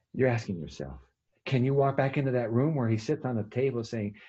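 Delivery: normal talking voice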